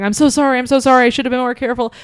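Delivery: high, loud, rushed apologies; imitating someone fussing apologetically; cooing apology. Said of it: "apologizing and cooing over him and going".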